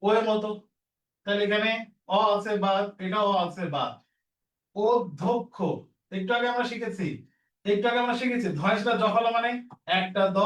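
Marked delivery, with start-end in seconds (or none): 4.8-5.6 অধ্যক্ষ as od-dhok-kho, syllables apart; careful pronunciation. Shown as a demonstration. Teacher modelling the word, careful syllables. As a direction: sound out অধ্যক্ষ with round o vowels. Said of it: "তাহলে এটা কি হবে উচ্চারণ?".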